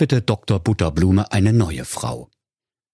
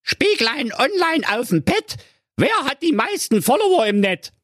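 the vain stepmother. A narrator's reading. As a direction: coo the magic question like a vain queen. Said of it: "und fragte".

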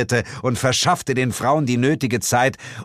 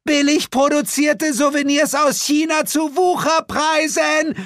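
very high, loud market cry; bellowing like a hawker; 4 dB louder than usual. Acted. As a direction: yell it to lure the crowd away. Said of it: "brüllte sie".

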